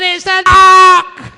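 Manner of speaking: very high, long, full-volume comic scream; hammed-up screaming. Husband at the peak of a silly quarrel, hammed-up screaming. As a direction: blast it out in a stretched shriek. Climax of acted argument.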